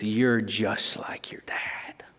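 slow hushed whisper, low and soft; whispered voice of God. Retold or imitated. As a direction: breathe it like a voice in the heart. God's truth voiced to teen.

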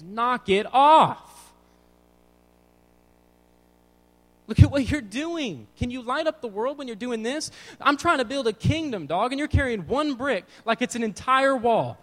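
high, loud, exasperated scolding as God; mock booming rebuke. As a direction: explode into a comic shouted telling-off. Acts out God's supposed little whisper yelling.

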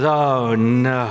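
a groaning, lightly voiced "oh no"; dismayed swim teacher. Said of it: "She goes".

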